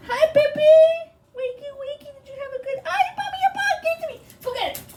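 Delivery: very high sing-song voice to a pet. Real, not acted. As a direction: coo at the dog. Talking to her pet.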